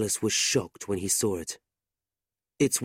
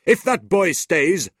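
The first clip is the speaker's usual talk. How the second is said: threatening uncle; 8 semitones higher, 8 dB louder than usual. A narrator's reading. Ralph threatens Mrs Nickleby, loud and cold. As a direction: threaten her coldly.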